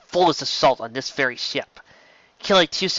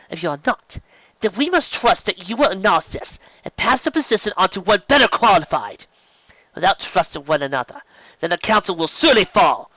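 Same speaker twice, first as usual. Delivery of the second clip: firm, rising oratory to the council; raised, insistent council speech; Sandoval, stern warrior; 5 semitones higher, 4 dB louder than usual. Acted. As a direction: lecture them with conviction.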